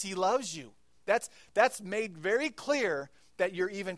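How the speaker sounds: high, loud impassioned preaching; preaching at full force. Real, not acted. Pastor urging the congregation, loud impassioned preaching. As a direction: proclaim it with fire.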